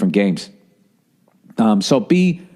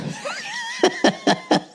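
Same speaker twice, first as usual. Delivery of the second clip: real laugh; 6 dB quieter than usual. Real, not acted. Comic laughs at imagined fight.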